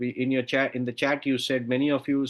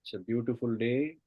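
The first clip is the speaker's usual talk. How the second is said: soft spa whisper; 4 dB quieter than usual. Acted. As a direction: lull them down with each count. Guided meditation countdown.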